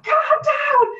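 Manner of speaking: high, loud indignant repeat; outraged echo. Retold or imitated. Repeating the passenger's words back at him, outraged and loud. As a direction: throw his words back, furious. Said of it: "And I'm like".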